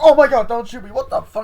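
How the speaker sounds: very loud, high mock panic; panicked shout. Girl facing the gun, panicked high shout. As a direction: cry out as if held at gunpoint.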